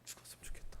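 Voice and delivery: unvoiced despairing whisper. Preacher voicing a weary person wishing to die. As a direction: breathe it out hopelessly. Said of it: "힘이 든 사람은요".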